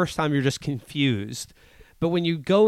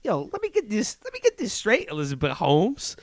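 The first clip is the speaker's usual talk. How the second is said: much higher, street-talk tease; mock interviewer; 12 semitones higher, 3 dB louder than usual. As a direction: needle her like a cocky reporter. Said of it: "you're like".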